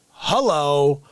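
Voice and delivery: ordinary talk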